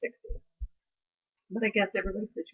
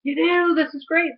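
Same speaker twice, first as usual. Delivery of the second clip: higher, louder, bright eager nurse; mock excited nurse; 9 semitones higher, 6 dB louder than usual. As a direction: cheer her on like a delighted nurse. Nurse excitedly telling her to walk.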